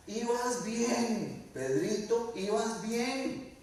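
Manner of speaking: very slow, high, soothing chide; tender chiding. Acted. Jesus gently telling Pedro he was doing well, tender. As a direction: coax him like a gentle teacher.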